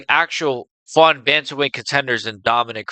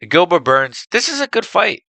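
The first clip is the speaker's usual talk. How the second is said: ordinary delivery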